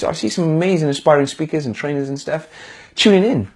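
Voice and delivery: normal talking voice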